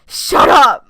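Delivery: loud sharp yell; angry shout. Real, not acted. Gamer yells shut up at the game. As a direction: snap it at the screen.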